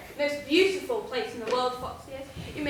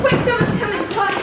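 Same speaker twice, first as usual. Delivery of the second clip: louder, higher, hurried alarm; hiss-free urgent call to hide, raised and sharp; urgent warning; 7 semitones higher, 8 dB louder than usual. Acted. Pirate warning his crew someone is coming, urgent and loud.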